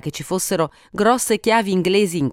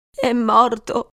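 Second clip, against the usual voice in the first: slow, higher-pitched cry of grief; exclaiming; 6 semitones higher, much slower than usual. A narrator's reading. Wife sees police by the body, grieving exclamation. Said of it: "esclamò lei".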